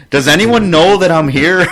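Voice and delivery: high, loud plea for attention; mock plea. Ignored regular walking into the bar, mock pleading. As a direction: plead to be noticed, half laughing.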